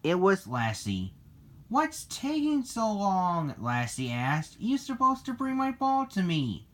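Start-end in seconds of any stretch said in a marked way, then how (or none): 1.7-3.5 high, stretched-out whine; impatient complaint. Acted. Lassie waits for her ball, high impatient complaint. 4.6-6.7 high-pitched scolding; impatient complaint. Acted. Lassie wants her ball brought back, high impatient nagging.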